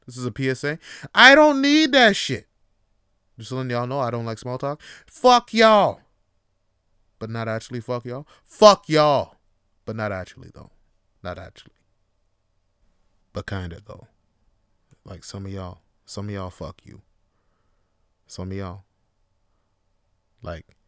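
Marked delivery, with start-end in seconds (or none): 1.1-2.5 higher, louder declaration; louder shouted PSA; loud repeat. Acted. Shouted again after PSA aside. 5.0-6.0 louder, higher fuck y'all; hammed-up loud fuck y'all; mock outburst. Mock outburst then retracted. 8.4-9.3 louder fuck y'all; hammed-up loud fuck y'all; loud mock outburst. Mock outburst then retracted. 9.8-20.8 much softer, lower retraction; quiet take-back. Real, not acted.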